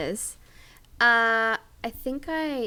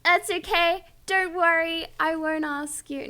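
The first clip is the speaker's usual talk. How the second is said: mock hurt; 6 semitones higher, 3 dB louder than usual. Pretends to be hurt, withdrawing request.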